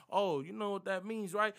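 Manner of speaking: ordinary delivery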